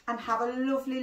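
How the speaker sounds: ordinary delivery, nothing marked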